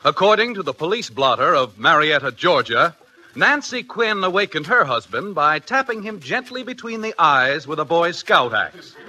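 ordinary talk

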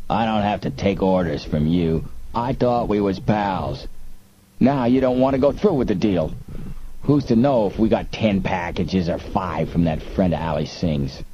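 second gangster talking back; rough arguing crook. A narrator's reading. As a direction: argue back like a disgruntled smuggler. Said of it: "said the second voice".

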